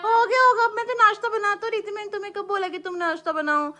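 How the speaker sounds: indignant protest. Real, not acted. Indignant quarrel over breakfast.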